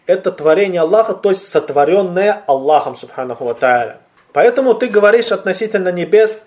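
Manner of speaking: ordinary delivery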